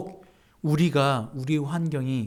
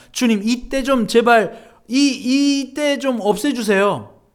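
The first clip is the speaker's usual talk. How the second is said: slow, louder pleading plea to the Lord; pleading prayer; 7 semitones higher, 6 dB louder, slower than usual. Retold or imitated. Preacher imitating a congregant's prayer, slow and pleading. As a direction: drag it out like begging God to take the hour away. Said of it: "우리는 이렇게 기도하잖아요".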